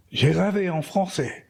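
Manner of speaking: proud little French line. Retold or imitated. Quoting self speaking French.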